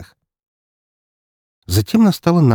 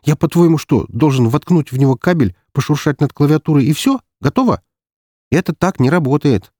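high-pitched, exasperated rant; affronted, sarcastic questioning; indignant; 7 semitones higher, 3 dB louder than usual. A narrator's reading. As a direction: scoff at how easy they think it is. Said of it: "возмутился Шабин".

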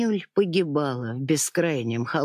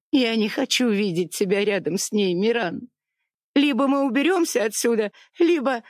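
much higher, fast, desperate threat; tearful ultimatum; 8 semitones higher than usual. A narrator's reading. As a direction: push the ultimatum through tears. (отчаянный ультиматум, выше и громче)

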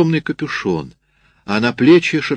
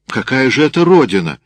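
loud, raised, disbelieving question; astonished; 4 semitones higher, 6 dB louder than usual. A narrator's reading. Sergeant puzzled by a farm called homeland, astonished. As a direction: challenge it with incredulity. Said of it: "пришла очередь удивляться сержанту".

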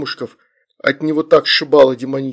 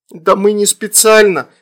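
put-on high child's protest; child's excuse; 6 semitones higher, 4 dB louder than usual. A narrator's reading. Girl excuses summoning to parents, childish protest. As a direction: plead innocence as a little girl.

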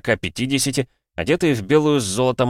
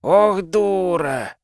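drawn-out high moan, slow; long despairing groan; 9 semitones higher, much slower than usual. A narrator's reading. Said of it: "застонал Файлер". (протяжный стон отчаяния)

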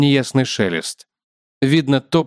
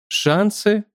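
higher, puzzled questioning echo; pondering; 8 semitones higher than usual. A narrator's reading. Spider echoes the man's question, pondering. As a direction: turn the word over as a question. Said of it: "задумался кусач".

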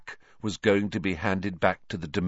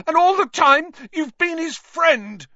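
very high, loud, furious outburst; shouting out of control; 9 dB louder than usual. A narrator's reading. Harry accusing Lupin, shouting out of control. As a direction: let betrayal push the shout out of control. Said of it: "his voice wavering out of control".

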